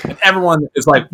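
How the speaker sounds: ordinary talk, nothing marked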